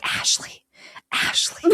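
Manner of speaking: imitated secretive whisper. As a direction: whisper the name as if calling to someone unseen. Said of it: "he keeps, like, whispering".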